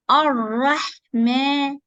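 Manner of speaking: Ar-Rahman slowly drawn out; slow recitation. Shown as a demonstration. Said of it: "not ma".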